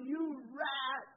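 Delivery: hammed-up a touch, scolding the pastor; a hint of fury at the hypocrite pastor; mock indignant. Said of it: "I just was so mad".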